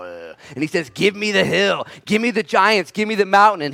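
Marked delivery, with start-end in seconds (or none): 0.9-3.6 high, forceful, repeated demand; rising battle-hungry insistence; fired-up warrior. Acted. Acts out Caleb demanding the mountain.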